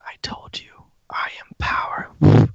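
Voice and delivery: whispered creature voice; whispering entity. Acted. Sword's voice speaks to player.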